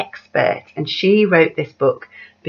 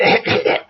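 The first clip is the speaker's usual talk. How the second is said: a mock whale cough; acted cough; 4 dB louder than usual. Acting out the whale coughing for the children, mock cough. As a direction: cough it out for the story. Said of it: "She coughed".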